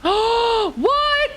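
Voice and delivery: sharp gasp then a high surprised What. Real, not acted. Streamer discovering a hidden spot, gasping in surprise. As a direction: caught off guard, gasp and squeak.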